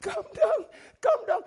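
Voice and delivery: high, thin mock begging; pleading upward, put on; mock feeble plea. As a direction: imitate a helpless prayer in a high voice. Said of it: "the righteous doesn't say".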